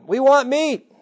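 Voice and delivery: high, mock whining demand; whiny complaining crowd. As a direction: whine like the hungry crowd. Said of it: "Israelites complaining for meat".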